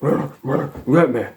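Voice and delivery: grunting it out like a dazed lug, a lightly voiced mimic; mock dazed grunting. After running head first into a wall, lightly mocking dazed grunts. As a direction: play him punch-drunk, grunting you get me. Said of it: "It'll be like".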